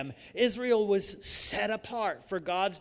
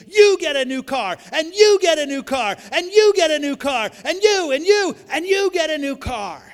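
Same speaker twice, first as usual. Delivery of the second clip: high, much louder giveaway shout; excited shouting; 8 semitones higher, 12 dB louder than usual. Retold or imitated. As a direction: shout it like handing out prizes to a cheering crowd. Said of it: "she started shouting".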